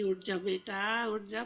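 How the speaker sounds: soft, slow loving coax; tender wake-up call. Retold or imitated. Mother gently waking her child, soft slow tender coax. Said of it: "माँ बड़े प्यार से कहेगी".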